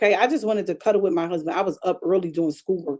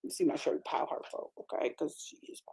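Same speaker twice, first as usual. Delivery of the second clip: soft unvoiced whisper; whispered aside; 4 semitones lower, 15 dB quieter than usual. Real, not acted. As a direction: murmur it as a secret aside. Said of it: "whispered aside".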